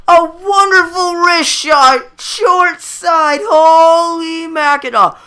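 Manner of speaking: high, still-thrilled commentary, slowing down; excited goal recap. Real, not acted. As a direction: keep the buzz of the goal going.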